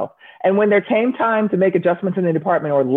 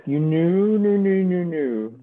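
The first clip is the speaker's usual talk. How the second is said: teasing; 4 dB quieter, slower than usual. Acted. Teasing repetition, fading.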